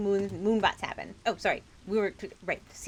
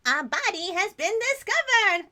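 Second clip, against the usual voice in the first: high, slow cartoon-bear announcement; Monokuma; 8 dB louder than usual. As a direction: gleefully announce the body like Monokuma. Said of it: "That's my Monokuma".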